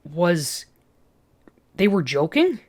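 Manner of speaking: ordinary delivery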